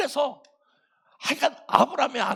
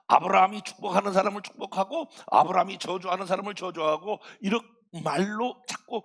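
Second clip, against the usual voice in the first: usual speaking voice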